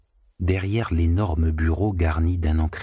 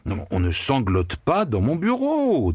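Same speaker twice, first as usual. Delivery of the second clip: angry; 5 semitones higher than usual. A narrator's reading. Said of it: "avec colère".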